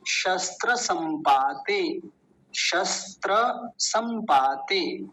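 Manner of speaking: शस्त्र संपाते spelt out slowly, every syllable clear; full form slowly. Shown as a demonstration. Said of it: "शस्त्र पूरा बोलना है". (शस्त्र संपाते धीरे-धीरे, पूरा जोड़कर)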